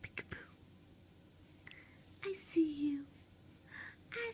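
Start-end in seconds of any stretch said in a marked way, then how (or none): 0.0-0.5 peekaboo whisper. Real, not acted. Mother whispering peekaboo to her baby, hushed.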